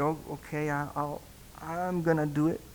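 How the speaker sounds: soft, halting giving in; retold hesitant agreement. Pastor gives in to husband, retold soft consent. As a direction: agree quietly, stumbling over the start.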